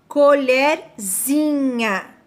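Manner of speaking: "colherzinha" stretched very slowly, suffix -zinha drawn out; slow teaching demo. Shown as a demonstration. Teacher to pupils, showing the diminutive very slowly. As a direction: say "colherzinha" slowly, leaning on the ending. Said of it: "Mudou o sentido da minha palavra".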